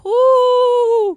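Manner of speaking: very high, loud cheer; excited whoop. Real, not acted. Hype cheer.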